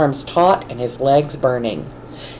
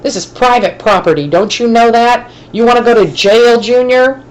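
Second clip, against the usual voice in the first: higher, louder foreman's yell; hollering; 8 semitones higher, 5 dB louder than usual. A narrator's reading. Foreman catching a boy on his lot, hollering. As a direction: bark the questions at the trespassing kid. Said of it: "the foreman hollered".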